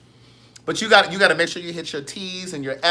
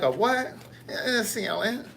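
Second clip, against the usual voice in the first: mock dialogue; 6 semitones higher, slower than usual. Mock exchange ordering salad.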